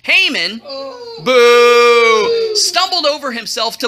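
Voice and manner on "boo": playful boo. Pretend. Storyteller booing the villain Haman, playful jeer.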